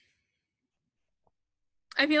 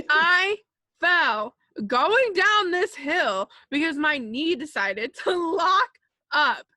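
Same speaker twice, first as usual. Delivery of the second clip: laughing; 5 semitones higher, 6 dB louder than usual. Real, not acted. Laughing retelling of embarrassing fall.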